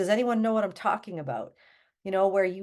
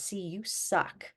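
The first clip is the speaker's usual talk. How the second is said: voice of shame; 5 dB quieter, slower than usual. Acted. Shame talking to someone struggling, softly critical. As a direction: quietly put yourself down, drawing it out. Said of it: "shame tells us".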